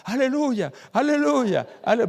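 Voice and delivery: high, loud, jubilant cry; shouting hallelujah. Retold or imitated. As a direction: imitate a convert yelling out praise. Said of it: "empieza a gritar".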